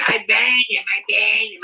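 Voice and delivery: mimicked protest. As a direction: repeat it insistently, mimicking him. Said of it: "and he's like".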